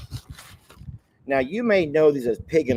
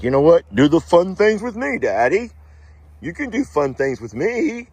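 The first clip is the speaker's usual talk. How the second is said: higher, louder put-on dog voice; bad Roman voice; 5 semitones higher, 5 dB louder than usual. Voicing Roman the dog begging Daddy, higher goofy voice. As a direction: give the dog a goofy kid voice. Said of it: "that's not a very good Roman voice".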